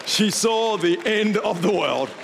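slow, drawn-out doom proclamation; hammed-up apocalyptic announcement; mock-dramatic. Real, not acted. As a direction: stretch it out like a movie-trailer doom line. Dramatic punchline about doom.

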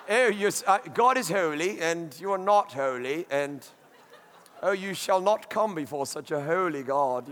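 low, mock-posh English accent with a laugh; lightly put-on snooty English accent. Preacher mocking snooty English churchgoers in a posh English accent. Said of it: "we have to do it with an English accent".

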